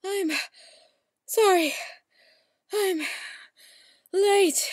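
acted breathlessness. Acting a late arrival.

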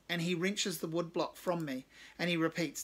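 usual speaking voice

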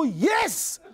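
big, high-pitched cheer of yes; exultant yes. Retold or imitated. Passenger celebrating free water in a taxi, exultant. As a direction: burst out with a triumphant yes. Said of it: "I didn't go, Yes, like that".

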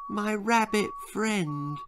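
put-on high, soft, slow little boy's voice; a confused child just waking up; a small boy asks, bewildered, soft and high; dazed boy. Boy explains to the doctor.

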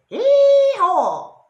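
very high, very loud shouted bray; donkey bray. A narrator's reading. Donkey scares the robbers, loud braying hee-haw. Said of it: "The donkey said".

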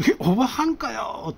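deflated surprise at the twist; comic double-take; stunned disbelief. Retold or imitated. Realizes it's a woman.

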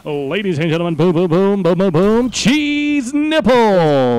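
hammed-up ringside introduction, rising as it goes; mock announcer. Announcing nonsense to friends in a game, mock announcer.

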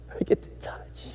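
breathless awed whisper to Jesus. Pretend. Worshipper at Jesus' feet, whispering in awe. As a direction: hush it like a secret prayer.

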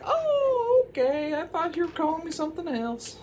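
high witch falsetto. Acted. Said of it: "the witch was like".

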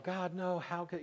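high, understated cry of disbelief; imitated wailing. Friends hearing Colleen just died, lightly imitated wail. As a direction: imitate a grieving wail, softening at the end. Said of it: "they're wailing and they're crying".

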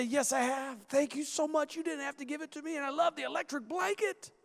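a hint of an embarrassed guest gushing thanks; sheepish thanks. Guest thanking the host for the bedroom, mildly sheepish.

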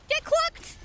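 P=A; high taunting squeal. Pretend. Taunting in chicken voice.

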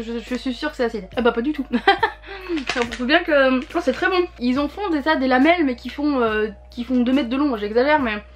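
talking between bites; chewing while talking. Real, not acted. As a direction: mouth partly full of candy. Seen 1: bites pink strip at -1.0 and 4.2, lips pursed chewing.

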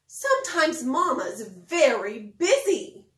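expressive picture-book reading; reading to children. Acted. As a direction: read it like bedtime story time for little kids.

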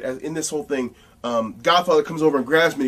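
ordinary delivery

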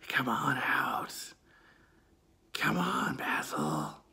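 slow, soft menacing whisper; eerie whisper. Retold or imitated. Voice whispering to Basil to come out. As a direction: call him out in a hush.

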